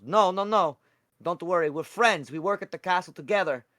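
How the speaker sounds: high, loud little cleric insisting; voiced Pickle Juice. Pickle Juice reassures a drained friend, high character voice.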